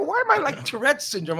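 high, self-mocking whine. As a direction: poke fun at yourself. Self-mocking quote about looking weird.

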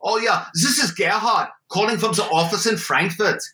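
put-on a German office caller, hurried and official, in a German accent; Gerhard's German accent. Con man poses as Frankfurt office caller: German accent. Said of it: "mine was German accent".

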